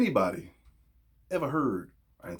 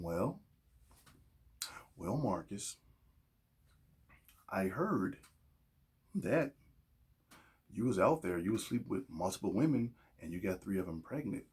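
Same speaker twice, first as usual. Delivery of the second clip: hushed, lower, halting parental talk; mock gentle reproach; 3 semitones lower, 7 dB quieter than usual. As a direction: softly chide with exaggerated tact. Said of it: "this crappy talk".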